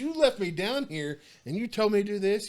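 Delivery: high, loud, accusing shout; angry protest. Retold or imitated. Guard shouting at coworker who left him alone with keys. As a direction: throw the blame right back at him. Continuing retold argument.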